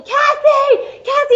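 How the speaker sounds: high, loud call across the room; retold yelling. Actor calls out for the author, loud and excited. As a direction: shout her name to get her over. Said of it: "he starts yelling".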